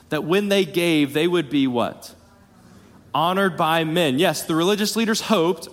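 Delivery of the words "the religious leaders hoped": higher phrase, leaning hard on "hoped,"; stress on HOPED. Shown as a demonstration. Preacher repeating the congregation's answer, stress on "hoped,". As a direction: make "hoped," the key word. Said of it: "I'm, I'm emphasizing this word hoped".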